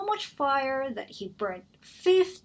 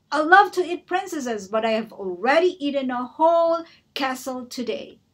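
much louder, higher roar; roaring dragon; 4 semitones higher, 8 dB louder than usual. Acted. The dragon losing patience with Elizabeth, roaring. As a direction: blast it at her, annoyed.

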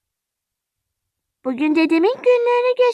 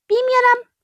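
usual speaking voice

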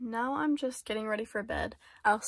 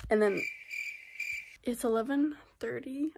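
usual speaking voice

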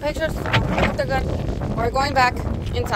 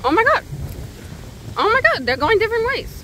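high excited shrieking; excited shrieks; 9 semitones higher than usual. Real, not acted. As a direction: squeal it out, thrilled. Shrieking in strong wind.

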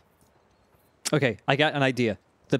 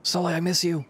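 put-on higher, childlike begging voice for attention; needy kid calling Sully; 4 semitones higher than usual. Gamer voicing Nate as a whiny kid wanting Sully's attention. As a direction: play a little kid desperate for Sully to watch.

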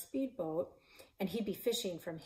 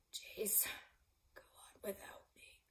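faint whisper; 2 semitones higher, 11 dB quieter than usual. Acted. Dying climber's last words.

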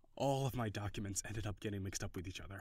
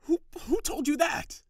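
stammering, much higher and louder, slowed by embarrassment; flustered; 10 dB louder than usual. Acted. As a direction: caught out by an old secret. Embarrassing story revealed.

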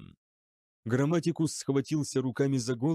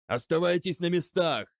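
bellowed order; 6 semitones higher, 3 dB louder than usual. A narrator's reading. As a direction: order bellowed high and loud at the troops. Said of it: "заорал Бронце". (громкий приказ, криком)

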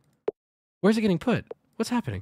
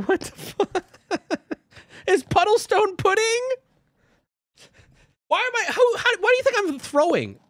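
baffled high exclaim. Real, not acted. Baffled by the game.

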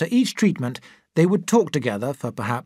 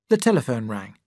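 ordinary delivery, nothing marked

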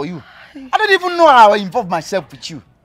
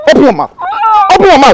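loud forcing shout, rising higher; yelling orders while forcing her mouth open; shouted command; 9 semitones higher, 11 dB louder, much faster than usual. Acted. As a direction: bark it at her, louder on the second.

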